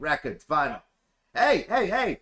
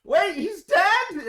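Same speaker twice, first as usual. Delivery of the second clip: shocked; 10 semitones higher, 5 dB louder, much slower than usual. Acted. GM exclaims in shock.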